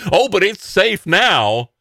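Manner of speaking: high, slow, dripping sarcasm; sarcastic. Acted. Host mocking Cuomo's safe-state boast, sarcastic. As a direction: drag it out mockingly.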